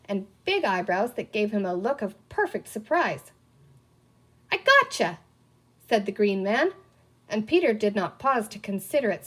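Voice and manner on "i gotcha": much higher gleeful taunt; triumphant kid. A narrator's reading. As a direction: crow it like a winning child. Green man triumphs in game.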